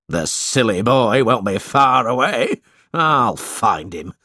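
lightly voiced cruel schoolmaster calling out; mild gruff call of the headmaster; Squeers crying out. A narrator's reading. Squeers sets off to hunt the boy, a lightly voiced cry. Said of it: "he cried".

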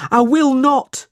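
high, loud, defiant cry. A narrator's reading. Smike clinging to Nicholas's arm, crying out in refusal. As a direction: refuse in a high put-on boy's voice. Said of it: "cried Smike".

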